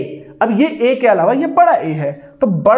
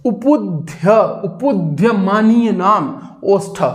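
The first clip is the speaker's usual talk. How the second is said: slow, deliberate sutra; slow teaching chant; slow recitation; slower than usual. Shown as a demonstration. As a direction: say it slowly for them to repeat. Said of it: "आप बोलिए उपुध्य".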